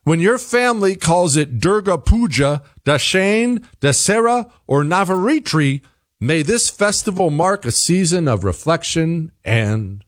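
high-pitched, mock-earnest greeting; mocking read-aloud. Reads a direct quote mockingly.

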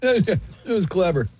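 laughing. Real, not acted. Laughing at own joke.

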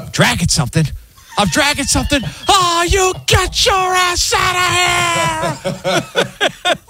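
high, slow building to a laughing yell; dad impression again. Retelling the dad's meltdown on request, yelling while laughing. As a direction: ham up the furious dad. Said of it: "He goes".

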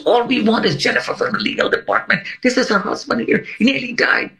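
high, urgent plea for the husband; urgent demand. Retold or imitated. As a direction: insist hard that she be fetched. Albert demanding at the lobby.

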